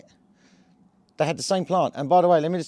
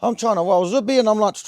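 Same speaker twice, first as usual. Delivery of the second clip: higher, louder, on edge; jittery and wound up; 8 semitones higher, 5 dB louder than usual. Retold or imitated. Telling how a mate's anxiety rubbed off, jittery. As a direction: sound wired with borrowed nerves. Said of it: "I ended up with his energy".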